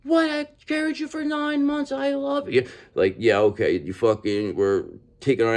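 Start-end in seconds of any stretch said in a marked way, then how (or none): 0.0-2.7 mother guilt-tripping her accusing child, mock falsetto; mock wounded mother. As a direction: squeak out a guilt-trip as the mom. Mom replying to accusation.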